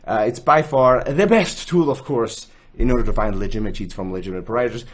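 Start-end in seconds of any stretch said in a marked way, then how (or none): none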